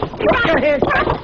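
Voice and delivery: high, slow panicked threat; panicked bluster. Acted. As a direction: squeak out a desperate stand-off. Frantic standoff over the baby.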